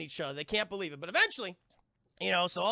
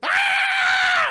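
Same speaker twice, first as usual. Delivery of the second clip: piercing, very loud damsel scream; imitated horror-film shriek; high-pitched scream; 16 dB louder than usual. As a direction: scream in terror at full force. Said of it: "high-pitched goddamn scream".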